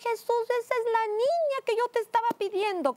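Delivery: very high, loud joyful cry to heaven; overjoyed exclamation. Retold or imitated. Woman thanking Jesus for a longed-for girl, high excited cry. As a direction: cry out in retold amazement and joy. Retells joy at longed-for daughter.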